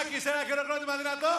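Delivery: high, emcee-style rousing call; applause call. Real, not acted. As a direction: whip up a big round of applause. MC calls for applause.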